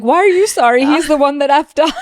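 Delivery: high, loud, indignant outburst; indignant disbelief. Real, not acted. Mock outrage at a show moment.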